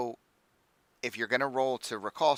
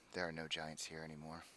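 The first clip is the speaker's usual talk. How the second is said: soft, sighing and sorrowful; sad; 5 semitones lower, 14 dB quieter than usual. Acted. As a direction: let the sadness weigh on it. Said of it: "there's kind of a sadness".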